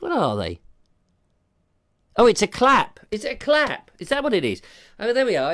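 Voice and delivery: normal talking voice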